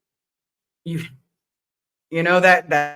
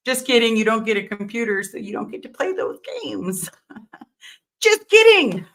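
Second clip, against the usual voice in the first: high sing-song letdown, rising into laughter; mock-gleeful taunt; 5 semitones higher than usual. Mock-breaking the no-computer news to a child, high and laughing. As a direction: tease in a mock-cheery high voice.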